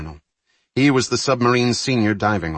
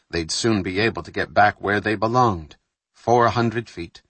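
usual speaking voice

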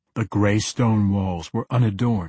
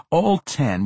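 usual speaking voice

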